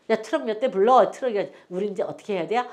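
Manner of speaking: ordinary talk, nothing marked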